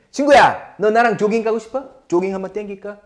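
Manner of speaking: bright, casual ask to a buddy; casual friend invite. Pretend. To a friend, asking to go jogging, casual and friendly. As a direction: invite your friend to jog, easygoing. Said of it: "딱 친구한테".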